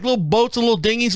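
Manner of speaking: ordinary talk, nothing marked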